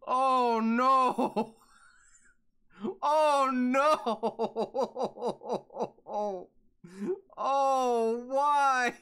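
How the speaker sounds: high, loud, drawn-out lament; dismayed wail. Real, not acted. Streamer dreads who is in the suit, dismayed wail. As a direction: wail it in comic despair.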